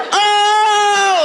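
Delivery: high, loud startled "Oh!"; a kid gasping out loud in surprise; shocked kid. Retold or imitated. Kids reacting to naked man.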